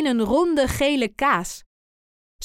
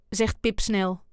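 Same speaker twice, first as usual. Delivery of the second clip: ordinary delivery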